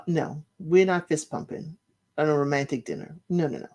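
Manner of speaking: ordinary delivery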